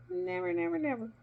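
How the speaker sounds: first NEVER high and hard, then falling; repeated emphatic never. Real, not acted. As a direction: press each never harder to drive it home. Insisting on danger.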